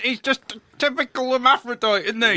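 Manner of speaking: high, loud character voice; high loud put-on voice. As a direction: squawk it in a silly voice. Put-on voice in the scene.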